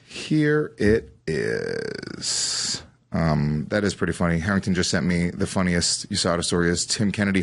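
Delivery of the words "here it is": deep slow reveal. Acted. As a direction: drop very low and drag it out. Dramatic reveal of a story.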